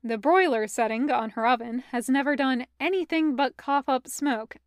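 ordinary delivery, nothing marked

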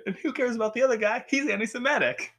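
high, quick mock character voice; hammed-up high sitcom aside; sitcom butt-in. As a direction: butt in with a cheesy punchline. Acted sitcom character butting in.

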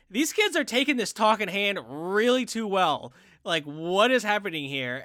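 can't believe what you are watching; retold bafflement. Podcaster retelling confusion at the movie, baffled.

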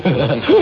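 a sharp, high, loud cackle; lustful cackle. Acted. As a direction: cackle at the thought of the women.

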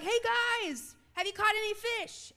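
high-pitched friendly holler; calling out. Acted. Preacher voices Jesus hailing the disciples, calling out. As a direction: call across the water to the fishermen. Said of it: "Jesus yells out to the disciples".

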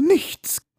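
"nichts" repeated in a high squeal; mock insistence. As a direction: pitch "nichts" way up for comic emphasis. Comic repetition after "ich wiederhole.

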